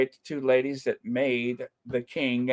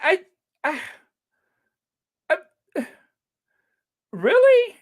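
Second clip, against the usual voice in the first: high squeal of disbelief; a playful shocked squeak; mock disbelief; 13 semitones higher, 4 dB louder than usual. Squealing in mock shock about the invite.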